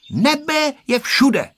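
high, slow, sure little statement; high-pitched certainty, drawn out slowly; decisive. A narrator's reading. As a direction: declare it firmly in a high voice. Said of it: "řekla určitě Tereska".

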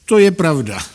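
tickled, high-pitched emphatic start that falls away; amused. A narrator's reading. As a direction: concede it with lively amusement. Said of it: "řekl inspektor pobaveně".

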